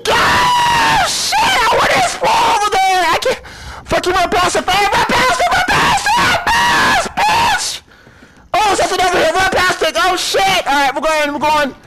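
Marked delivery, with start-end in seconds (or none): 0.1-7.8 frantic high yelling; panicked shouting. Real, not acted. Fleeing a monster in-game.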